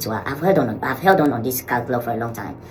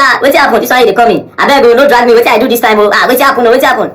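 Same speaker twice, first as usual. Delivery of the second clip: alarmed; 11 semitones higher, 13 dB louder than usual. Retold or imitated. Jude alarmed at unexpected call.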